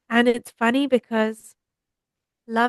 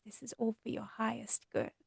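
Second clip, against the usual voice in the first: soft divine message; 14 dB quieter than usual. Pretend. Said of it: "a shower of divine energy that says".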